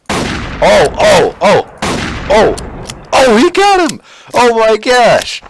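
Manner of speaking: very high, loud bursts of amazement; excited yelling. Real, not acted. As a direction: yelp through a frantic firefight, then erupt. Frantic firefight ends in kill.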